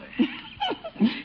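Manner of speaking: very high, breathy giggle. Acted. Housekeeper giggling about Leroy, high giggle. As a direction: giggle at the boy. Bertie laughs.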